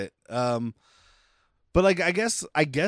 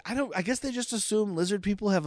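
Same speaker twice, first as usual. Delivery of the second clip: ordinary delivery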